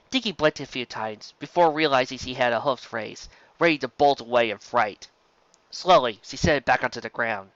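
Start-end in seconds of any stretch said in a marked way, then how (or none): none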